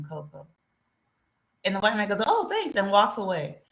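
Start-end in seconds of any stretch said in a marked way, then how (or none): 2.3-2.7 high breezy thanks; lightly mocking the tourist; casual thanks. Imitating tourist taking free cocoa.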